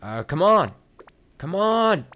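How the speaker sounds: loud, rising exasperated shout; frustrated. Real, not acted. Streamer frustrated by a game enemy, shouting. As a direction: yell at the game to hurry up.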